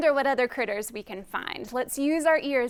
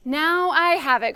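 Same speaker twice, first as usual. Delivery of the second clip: louder, eager announcement; excited cry; 3 semitones higher, 6 dB louder than usual. Acted. Little bird announcing his new idea, excited cry. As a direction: mildly cry out a sudden idea. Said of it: "cried the little bird".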